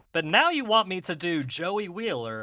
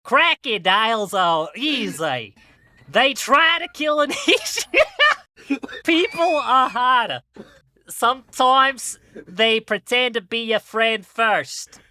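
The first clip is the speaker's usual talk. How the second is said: hammed-up Aussie croc hunter accent; Steve Irwin impression; 4 semitones higher than usual. Giving life advice as a khaki-clad wildlife host, in a hammed mock Australian accent. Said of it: "I'm gonna be Australian".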